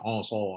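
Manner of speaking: Asau said as oso; over-round Asau into oso; wrong oso sound. Shown as a demonstration. Teacher demonstrates the wrong reading. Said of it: "Tak boleh macam".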